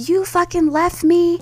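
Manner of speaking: put-on high, slow accusing drunk whine; drunk sister voice. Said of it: "She was like".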